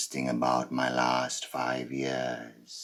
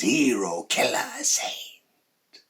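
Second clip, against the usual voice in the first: loud, high opening, then drawn out; punchy mock acclaim; 7 semitones higher, 4 dB louder, slower than usual. People naming the killer all in one sentence, loud acclaim. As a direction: proclaim it like a slogan. Mimics what people used to say.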